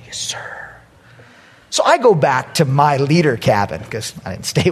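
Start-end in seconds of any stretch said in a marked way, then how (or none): 0.1-0.5 whispered yes sir. Retold or imitated. Kids answering the pastor.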